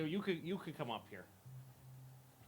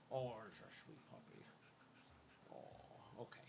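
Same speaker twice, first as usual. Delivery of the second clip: tender coo; 6 semitones lower, 18 dB quieter than usual. Real, not acted. Cooing at the dog.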